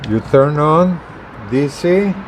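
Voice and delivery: ordinary delivery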